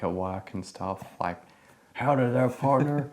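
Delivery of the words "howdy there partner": high, loud cowboy greeting. Shown as a demonstration. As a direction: tip your hat, howdy partner. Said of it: "I started doing cowboy voices".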